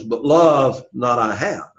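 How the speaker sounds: "love" stretched out and stressed; slow right down to weight "love"; emphasis on love. Shown as a demonstration. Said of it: "important word there is the word love".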